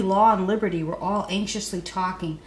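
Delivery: ordinary delivery, nothing marked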